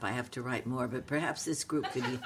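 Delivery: ordinary delivery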